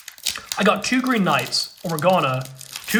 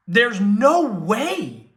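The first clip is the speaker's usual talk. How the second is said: awed disbelief; 4 semitones higher, 4 dB louder, much slower than usual. Real, not acted. Awed at rare pull.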